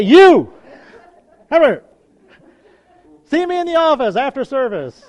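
loud, high mock summons; single them out with a sharp yell; stern calling-out. Church leader to an unclean visitor, stern shout.

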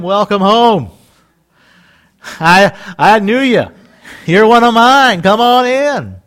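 joyful, booming greeting; hearty welcome. Acted. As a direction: call out a big welcome home. God welcoming him home.

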